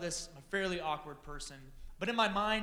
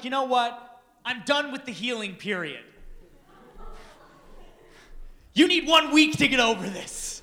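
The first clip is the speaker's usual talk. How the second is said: loud, rising, high-energy pep talk; pumped-up self-talk; 6 semitones higher, 10 dB louder than usual. Retold or imitated. Talking himself into asking Kim out, loudly excited. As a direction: hype yourself up, getting louder to the end. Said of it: "And I was like".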